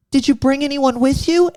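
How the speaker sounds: high-pitched mock judge's question; imitated TV judge. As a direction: ask it in a put-on high voice. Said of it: "she goes".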